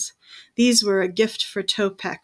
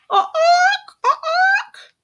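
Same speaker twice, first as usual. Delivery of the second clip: very high shrieking bird cry; thunderbird; 5 dB louder than usual. Acted. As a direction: screech like a giant bird. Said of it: "the great bird cried out".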